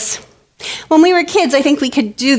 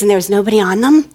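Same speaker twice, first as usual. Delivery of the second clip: ordinary talk, nothing marked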